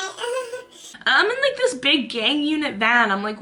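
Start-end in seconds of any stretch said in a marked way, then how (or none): 0.0-0.6 high-pitched imitated whimper; short high mock groan; pained groan. Forced to stare into light, whimpering groan. Retold reaction to red light.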